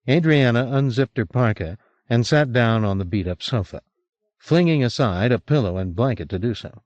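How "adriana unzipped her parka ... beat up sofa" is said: usual speaking voice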